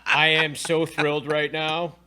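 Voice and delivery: loud, overdone show of being thrilled; mock thrilled. Host answering co-host's teasing, hammed-up thrill. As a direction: play up the thrill for a laugh. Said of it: "Can you hear it in my voice?".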